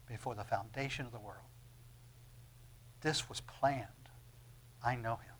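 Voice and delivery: low, soft, measured voice of Jesus; calm assurance. Acted. Preacher voicing Jesus answering about the blind man, calm assurance. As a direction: speak low and gently, with settled certainty.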